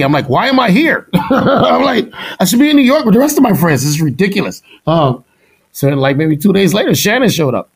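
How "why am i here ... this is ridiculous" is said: higher, laughing, fast disbelieving gripe; tossing off an exasperated why-am-I-here; laughing indignant complaint. Retold or imitated. Cocky student griping to a teacher, laughing indignation.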